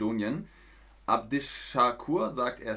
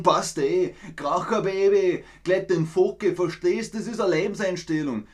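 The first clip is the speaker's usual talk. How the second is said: loud, high Austrian dialect; hammed-up Austrian country talk; Austrian dialect demo; 6 semitones higher, 5 dB louder than usual. Shown as a demonstration. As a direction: show off a thick rural Austrian dialect. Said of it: "Das hört sich so an".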